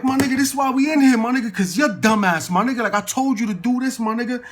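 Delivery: loud, high-pitched telling-off; acted outrage at a friend's stupidity; exasperated scolding. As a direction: chew him out in a retold rant.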